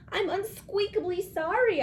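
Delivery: sorry little squeak; squeaky hamster. A narrator's reading. Hamster apologising to the teacher, squeaky. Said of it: "I told her".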